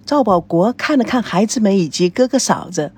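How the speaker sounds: ordinary delivery, nothing marked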